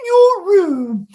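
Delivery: screeching, high, loud, slow falsetto; mocking nagging mum. Parodying a sketch mum nagging her son, loud high falsetto. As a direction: caricature a shrill nagging mum. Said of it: "walk around being like".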